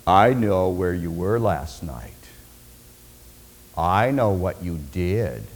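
very low, slow and drawn out; deep, knowing, unhurried menace; ominous mind reader. Acted. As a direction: say it like you know their secret.

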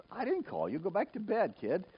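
high-pitched, mildly put-out reply; acting out a bothered elder; dismissive denial. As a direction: send the kid back to bed. Eli dismisses the waking boy.

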